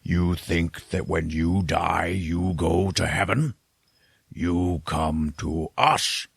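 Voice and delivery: very slow villain taunt, drawn out; menacing Tall Man. Retold or imitated. Tall Man taunting the choking priest, slow and ominous. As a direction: draw out each word with dark menace. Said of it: "walks up to him and says".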